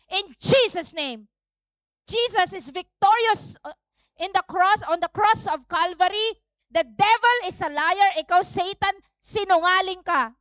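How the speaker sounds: high, loud, combative sermon; forceful revival-style declaration; full-force preaching. Real, not acted. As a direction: thunder against the devil. Preaches against the devil.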